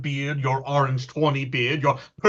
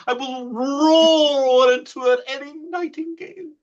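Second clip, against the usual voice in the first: gentle roar; 9 semitones higher, 3 dB louder than usual. Shown as a demonstration. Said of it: "I will aggravate my voice so that I will roar you as gently".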